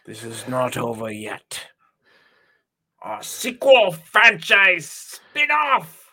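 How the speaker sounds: slow, rising mock menace; mock-grand villain vow; hammed-up villain threat. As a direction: end on a high, loud hammy promise.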